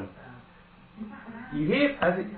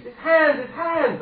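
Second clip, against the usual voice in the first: much higher and louder panicked cry; terrified scream; 7 dB louder, slower than usual. Acted. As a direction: scream as he seizes you. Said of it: "who screams".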